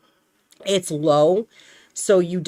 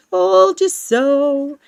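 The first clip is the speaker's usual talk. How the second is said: told you so; 8 semitones higher, 5 dB louder, much slower than usual. Retold or imitated. Gloating to her friend.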